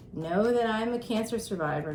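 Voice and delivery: normal talking voice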